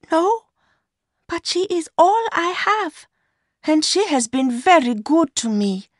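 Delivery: put-on high-pitched young woman's voice; Mercy, defending her sister. A narrator's reading. Mistress defending her sister to her lover, high young woman's voice.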